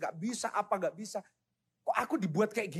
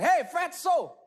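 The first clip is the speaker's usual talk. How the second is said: higher, louder mocking yell; school bully taunt; 11 semitones higher, 4 dB louder, much slower than usual. School bully mocking young Michael, higher and louder jeer. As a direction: jeer at the fat kid across the schoolyard. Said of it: "di sekolah dipanggil".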